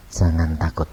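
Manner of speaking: low, gentle voice of God. Retold or imitated. God calms a frightened person, gentle and low. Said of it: "suaranya itu lembut mengatakan".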